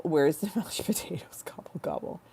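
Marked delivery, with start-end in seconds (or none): 1.5-2.2 soft unvoiced turkey gobble; whispered gobble.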